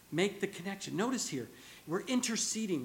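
ordinary talk, nothing marked

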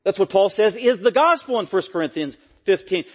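usual speaking voice

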